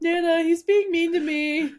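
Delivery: high, loud, slow tattling whine; whiny child. Pretend. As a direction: complain like a tattling kid. Joking tattletale call to Nana.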